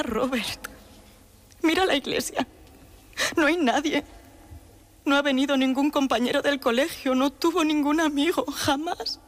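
high-pitched grieving protest; lamento de madre. Acted. Mother laments at empty church. (lamento dolido, en tono agudo)

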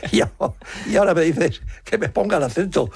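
hammed-up disbelief, as if it's absurd; mock indignant protest. An actor retelling his protest to the director, mock indignant. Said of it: "le dije".

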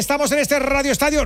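high, projected station call; shouted radio announcement. Real, not acted. Host announcing Radio Estadio to listeners, shouted high. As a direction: shout it high, the only way you know. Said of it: "Lo tengo que gritar así".

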